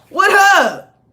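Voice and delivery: loud, high, laughing "what up"; laughing greeting. Real, not acted. Reader laughing while greeting the spirit, loud and high. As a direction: toss out a playful laughing hello.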